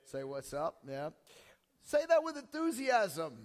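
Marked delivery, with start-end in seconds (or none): 1.8-3.4 high, loud rallying call; enthusiastic urging. Real, not acted. Pastor urging the congregation to answer back, enthusiastic. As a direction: rouse the room with raised pitch and volume.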